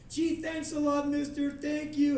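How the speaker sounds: put-on high, sweet child voice; high-pitched imagined kid's thanks; grateful little kid. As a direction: gush thanks like a small boy. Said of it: "he's just gonna go like".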